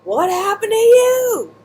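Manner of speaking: high, slow, alarmed friend rushing over; worried friend. Retold or imitated. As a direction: stretch it out as the shocked friend running up. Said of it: "she comes running up to me".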